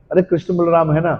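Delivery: usual speaking voice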